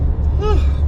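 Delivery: long high yawn-sigh, falling; end-of-yawn sigh. Real, not acted. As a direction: let out a big tired yawn. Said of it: "yawning now".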